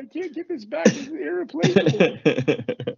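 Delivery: very high, hammed-up tearful whine; whining and crying. Retelling losing it over his wedding ring, high crying whine. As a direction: wail it in mock despair, dragging the end. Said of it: "Again with the whining and crying".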